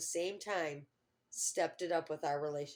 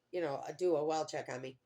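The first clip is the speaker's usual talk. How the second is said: usual speaking voice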